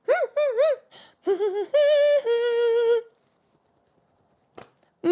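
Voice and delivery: high whining puppy hums; puppy whimpers. Retold or imitated. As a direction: whine like a dog stuck in a kennel. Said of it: "Grandpa goes".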